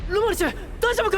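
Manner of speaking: fast, high worried shout; alarmed call. Acted. 仲間を心配して慌てて叫ぶ.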